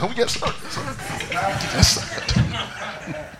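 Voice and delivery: breathy, timid yes sir; scared siblings. Retold or imitated. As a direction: answer a strict father meekly. Scared siblings answering dad.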